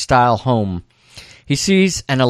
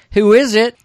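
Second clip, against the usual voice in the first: high, louder voice for a woman; woman calling out; 9 semitones higher, 5 dB louder than usual. Retold or imitated. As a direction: call through the door in a higher voice. Voices woman behind the door.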